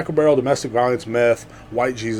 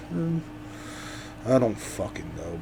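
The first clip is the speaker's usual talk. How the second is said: normal talking voice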